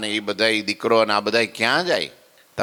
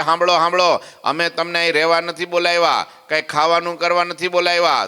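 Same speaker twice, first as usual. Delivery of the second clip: raised, higher call for attention; announcing to the assembly; 6 semitones higher than usual. Retold or imitated. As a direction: call the whole hall to listen. Maharaj addresses the assembly.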